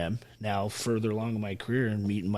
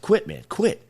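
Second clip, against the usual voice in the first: higher, louder inner-voice urging; urging oneself to quit; 9 semitones higher, 5 dB louder, slower than usual. Acted. Soldier telling himself to give up mid-task, acted urging. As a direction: act out the voice in your head begging off. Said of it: "you're telling yourself".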